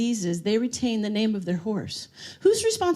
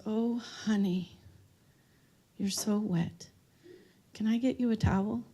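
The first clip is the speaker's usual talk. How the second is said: put-on quieter, slow motherly old lady; soft old lady; 3 semitones lower, 7 dB quieter, slower than usual. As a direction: speak like a kind elderly resident. Old lady in nursing home.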